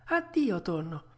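high, light voice falling away; puppet's farewell. Acted. Puppet bids the tuna goodbye, high light voice. Puppet takes his leave.

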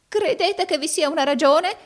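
high, loud, rattled question; flustered exclamation. A narrator's reading. Arturo alarmed by Rodolfo's hint, flustered high exclamation. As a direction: blurt it out high, badly unsettled. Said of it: "esclamò balbettando Arturo".

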